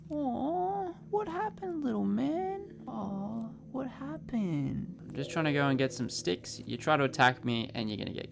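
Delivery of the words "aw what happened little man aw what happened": high, cooing mock sympathy; mock baby talk. Gamer taunting the beaten bear in baby talk. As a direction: coo at the dead bear like a baby.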